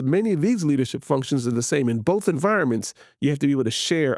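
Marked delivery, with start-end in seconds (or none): none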